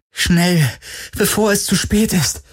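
high-pitched, pressing urgency; urgent plea. Acted. Heroine sending guards to her father, urgent plea.

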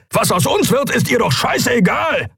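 high-pitched shouted accusation; angry outburst. Acted. Keru accusing Endriel of not caring, angry shout. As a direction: let the anger break into a shout.

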